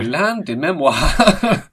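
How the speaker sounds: drawn-out, hammed-up French pronunciation; mock French accent. Joker offers French name for memory lane, mock French accent. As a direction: stretch it out slowly in pretend French. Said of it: "as the French would have it".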